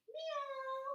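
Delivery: high, soft drawn-out meows; cat meow. Pretend. Teacher acting out cat pose for kids, high meowing. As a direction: mew like a little cat.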